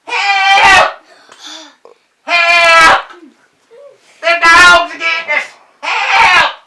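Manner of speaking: high, loud mock-panic holler; hollering for help. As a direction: scream out a false alarm across the fields. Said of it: "we began to holler".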